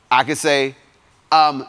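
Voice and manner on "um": ordinary talk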